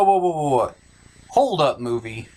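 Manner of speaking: usual speaking voice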